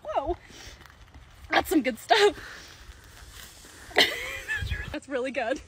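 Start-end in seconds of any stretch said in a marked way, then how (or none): 3.9-4.9 laugh. Real, not acted. Tasting the Grimace Shake.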